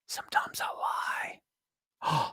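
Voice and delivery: whispered confession. Pretend. Host whispering a joking confession to the audience. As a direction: breathe it like a secret.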